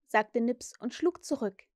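usual speaking voice

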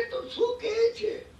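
high, slow stunned question; retold cry of amazement, pitched high; astonished disbelief. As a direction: ask it as if you can't believe your ears. Merchant can't believe his luck.

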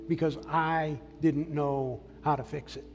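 normal talking voice

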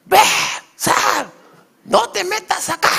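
very high, loud, fast shout; furious shout. Shown as a demonstration. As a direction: yell to drive someone out of the room. (grito furioso, muy agudo)

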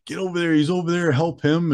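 high urgent call for help; yelling. Retold or imitated. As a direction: call out to the rangers in alarm. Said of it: "hearing some yelling".

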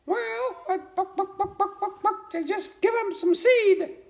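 very high hen voice, clucking through it. Acted. Mama hen telling the frog to feed seed, high clucking hen voice.

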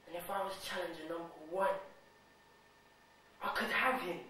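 soft, inward dawning thought; hushed daydream of winning, unhurried gaps; quiet realisation. Acted. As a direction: think it aloud softly, with long pauses. Quiet realisation he could be champ.

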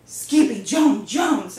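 loud, drawn-out shout of the name; everybody crying out. A narrator's reading. Family finds the kitty boy amid the mess, shouting his name. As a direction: holler each word of the name. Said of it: "everybody cried".

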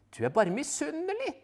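wife teasing her husband. Acted. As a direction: needle your husband. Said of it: "sier Paprika".

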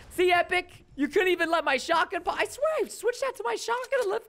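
high, exasperated protest to the game maker; angry complaint. Real, not acted. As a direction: pitch climbing as you plead your case. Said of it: "I'm angry right now".

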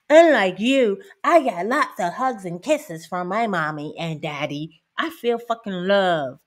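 ordinary delivery

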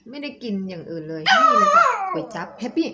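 high, loud call to the dog; excited. Real, not acted. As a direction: cry out high, calling the pet.

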